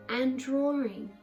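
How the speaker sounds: usual speaking voice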